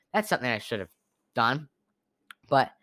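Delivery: normal talking voice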